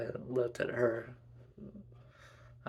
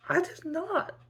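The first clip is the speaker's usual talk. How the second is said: put-on baby sister's squeaky-high denial; high-pitched protest. A narrator's reading. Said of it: "she protested".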